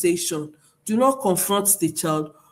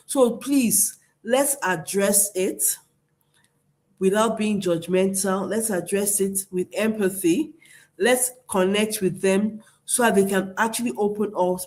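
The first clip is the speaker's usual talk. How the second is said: ordinary delivery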